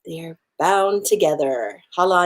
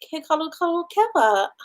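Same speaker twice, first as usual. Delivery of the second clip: high, quick, innocent reply; sheepish answer; 10 semitones higher, 3 dB quieter, faster than usual. Retold or imitated. Granddaughter sheepishly naming her husband, high and fast. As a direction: play it innocently.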